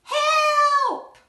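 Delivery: high, loud panicked cry; shout for help. Acted. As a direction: scream it for someone to come. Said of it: "She's shouting".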